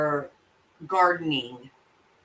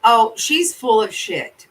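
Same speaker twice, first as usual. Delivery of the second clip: loud insistence; 5 semitones higher, 7 dB louder, slower than usual. Real, not acted. Venting about the case.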